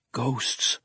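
shaken sputter. A narrator's reading. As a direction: let it out softly, shaken. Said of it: "Bruner sputtered".

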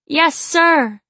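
high, loud ecstatic cry; cried out. A narrator's reading. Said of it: "she cried".

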